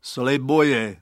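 choked, muffled shout; muffled call. A narrator's reading. Buried bully promising to behave, muffled call. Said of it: "zvolal přidušeně zasypanec".

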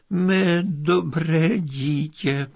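high, slow, moved father's voice; tearful. A narrator's reading. Prince to the daughter who sacrifices herself, tearful. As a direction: draw it out as if near tears. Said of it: "s pláčem políbil dceru svou".

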